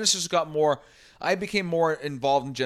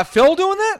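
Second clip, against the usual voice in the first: incredulous; 7 dB louder, slower than usual. Real, not acted. Incredulous about singer's song.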